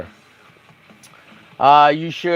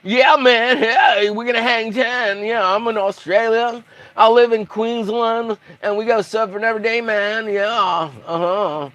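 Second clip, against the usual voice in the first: mock Australian surfer; 5 semitones higher than usual. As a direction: play a stoked Australian surfer dude. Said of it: "like, a surfer guy".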